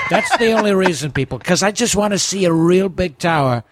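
Trump voice, bragging; brash Trump-style bragging; Trump impression. Doing Trump voice on podcast.

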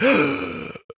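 exaggerated sharp intake of breath; mock shocked gasp. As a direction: gasp like a clutched pearl. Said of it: "They're like".

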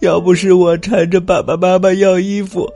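high, loud wailing sobs; crying and shouting. Acted. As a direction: sob out the words in a loud, high wail. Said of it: "边哭边喊".

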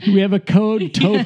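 mock store announcement. Clerk alerting the store staff, mock announcement. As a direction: call it out like a store code.

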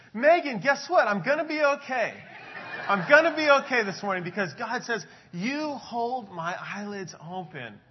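high, loud, overjoyed news to his wife; bursting with relief, laughing; excited. Retold or imitated. Pastor phoning his wife with good news, loud excitement. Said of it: "I was so excited".

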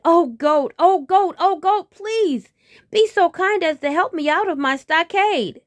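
loud, high-pitched begging; desperate pleading. Acted. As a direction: beg the goat urgently for rescue. Trapped tiger begs goat for help.

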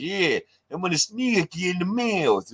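high-pitched, exaggerated Detroit-Chicago accent; mock the Detroit accent with heavily bent vowels. Showing co-host why the line sucks, exaggerated Detroit accent. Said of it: "he has the Detroit accent where they say like".

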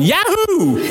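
very high hammed-up cowboy yell; cowboy whoop. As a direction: let out a joking rodeo yahoo. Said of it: "I'll be up there with a cowboy hat".

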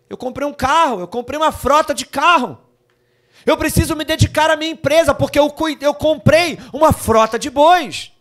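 hammed-up excuse-maker; mock excuse. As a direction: play the busy man brushing God off. Mocking excuse, louder.

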